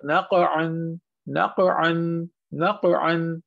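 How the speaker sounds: thick q in नक़अन with a bounce after it; नक़अन like "naqa-an", q heavy and echoed; heavy qalqalah bounce. Shown as a demonstration. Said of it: "ये दोनों चीजें होनी है".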